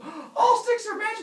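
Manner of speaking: very high, loud gasp of wonder; awed excitement. Acted. Humans misunderstand the god, thrilled shriek.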